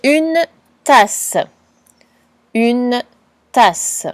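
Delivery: each word spoken very slowly and clearly; drawn-out dictation voice. Shown as a demonstration. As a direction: dictate it slowly for a child to write down. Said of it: "Dernier mot".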